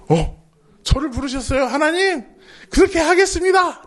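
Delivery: high, bright mock-eager reply with a laugh; eager Moses. Moses gladly accepting God's call, mock-eager and high. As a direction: answer cheerfully, lightly laughing. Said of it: "이게 아니라".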